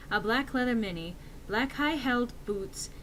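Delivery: ordinary delivery, nothing marked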